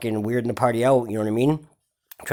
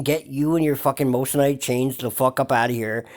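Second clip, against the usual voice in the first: ordinary delivery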